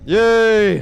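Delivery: high, loud yay; excited cheer. Real, not acted. Streamer cheering a hook in game, excited. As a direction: celebrate the win.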